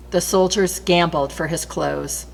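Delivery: usual speaking voice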